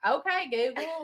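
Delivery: slow Southern drawl on Okay, Google; country accent. Shown as a demonstration. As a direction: stretch out Okay, Google slowly in a country twang. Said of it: "mine sounds country".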